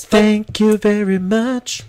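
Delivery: very high, slow, loud squeak; mock showy thanks. Host thanking pretend studio audience, high and showy. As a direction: draw it out in a high silly voice.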